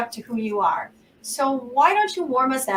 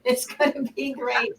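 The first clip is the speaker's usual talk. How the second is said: ordinary talk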